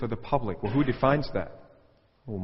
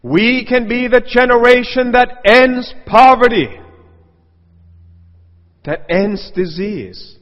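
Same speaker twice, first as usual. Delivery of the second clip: much higher, louder, slow orator's voice; mock grand speech; 10 semitones higher, 13 dB louder, slower than usual. Preacher mocking a promise to end poverty, slow and booming.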